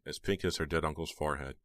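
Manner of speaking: ordinary delivery, nothing marked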